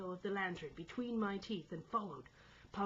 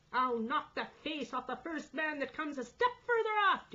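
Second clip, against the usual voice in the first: loud, high bullying threat; angry shout; 9 semitones higher, 8 dB louder than usual. A narrator's reading. Mate threatening the crew, angry shout. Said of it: "he shouted".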